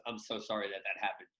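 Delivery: apologetic official. Retold or imitated. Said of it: "walked over to me and goes".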